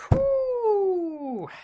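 high, loud disbelieving whew; long loud whew. Real, not acted. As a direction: blow out a big shocked whew. Reacting to Whedon's article.

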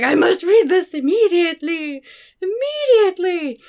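high, loud, hammed-up urgency; theatrical, high-pitched eagerness; urgent exclamation. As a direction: play up the desperate need. Acting out her urgent reaction.

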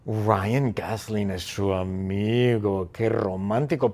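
slow, low dreamy sigh; hammed-up romantic swoon; mock swooning. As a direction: gush dreamily, stretching the words out. Mock swooning over Ryan Gosling.

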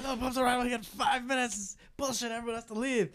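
high, frantic French manager shouting; frantic yell. Retold or imitated. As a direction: lose your mind and yell it. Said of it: "losing his mind".

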